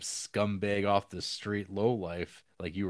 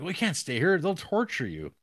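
raised, urgent warning, higher; higher, louder urgent warning; urgent plea; 9 semitones higher, 5 dB louder than usual. Retold or imitated. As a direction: push it like a frantic warning. Retelling film, urgent plea.

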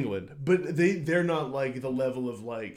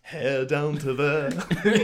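slow, drawn-out, louder lyric imitation; molasses delivery; 5 dB louder, much slower than usual. Podcaster imitating the singer's lines for a co-host, molasses delivery. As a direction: drag the words out like molasses. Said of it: "It's like this molasses kinda, yeah, delivery".

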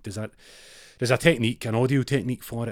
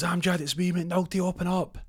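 loud high plea at the door; McNulty begging Amjad to open up, high and loud; high urgent call; 10 semitones higher, 4 dB louder than usual. Retold or imitated. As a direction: call through the shutter. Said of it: "he goes".

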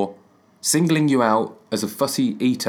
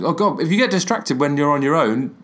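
normal talking voice

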